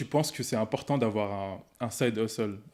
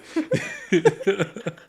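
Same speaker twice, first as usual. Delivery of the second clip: éclat de rire gêné; rire nerveux; 9 semitones higher, 7 dB louder than usual. Real, not acted. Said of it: "je rigole parce que tu rigoles". (rire nerveux)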